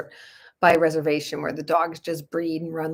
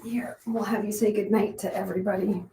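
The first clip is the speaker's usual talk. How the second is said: gentle whisper to the dog; 3 semitones higher than usual. Real, not acted. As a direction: hush it, as if others sleep nearby. Hushed talk, late at night.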